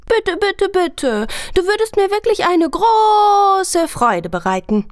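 put-on very high, insistent little boy; begging child. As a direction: beg and wheedle like a child. Junge bettelt mit treuem Blick. (hohe, bettelnde Kinderstimme)

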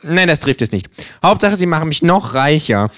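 höher, fordernde Känguru-Stimme; freches Känguru. Acted. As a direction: spiel das Känguru dreist und provokant. Känguru korrigiert sich. (höhere, dreist fordernde Känguru-Stimme)

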